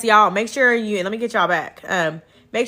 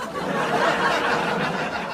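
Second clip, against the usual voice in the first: breathy laugh; 8 semitones higher than usual. Real, not acted. Laughs about TikTok perks.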